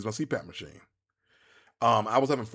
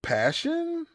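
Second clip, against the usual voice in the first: much higher, drawn-out passion; excited. Real, not acted. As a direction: sing out the word with excitement. Stretched word, rising enthusiasm.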